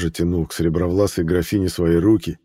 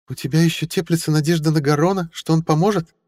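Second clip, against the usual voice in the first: put-on high, teasing old lady; countess voice; 10 semitones higher than usual. A narrator's reading. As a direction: needle a rival sweetly. Mariel voiced in the story.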